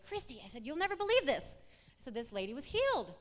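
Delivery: high, loud retold excitement; excited news-breaking. Telling Christy about a healed lady, excited. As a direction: burst out with news for a friend. Retelling big news to friend.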